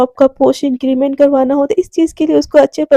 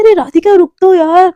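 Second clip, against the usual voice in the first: calling out; 4 semitones higher, 4 dB louder than usual. Retold or imitated. Neha calls after Radhika.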